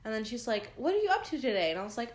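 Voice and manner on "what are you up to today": imitating a perky, friendly girl; higher, louder, chatty question; sweet, eager. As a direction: ask it with bubbly interest. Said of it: "she was just so sweet".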